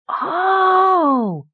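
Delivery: high, long-drawn "oh" of wonder; a child's high gasp of awe; awed. A narrator's reading. Boy first seeing the sorcerer, wide-eyed awe. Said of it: "Tolo had gone wide-eyed with awe".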